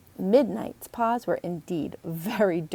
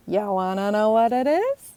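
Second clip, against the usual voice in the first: put-on louder, sly girl voice; Emily teasing; 6 dB louder than usual. Emily teases Logan about the surprise, sly and louder. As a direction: dangle the secret slyly.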